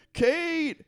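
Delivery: high, loud call of a name; imitating a frantic search call. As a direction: yell across the cave for her. Calling for lost friends in cave.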